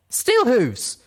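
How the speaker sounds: high, loud desperate call. Acted. Little Pip calling Steelhooves from the doorway, loud and high. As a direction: call his name across the room. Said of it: "I called out, panting".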